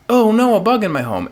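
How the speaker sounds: high-pitched playful dismay; mock alarm. Retells alarm at seeing bug.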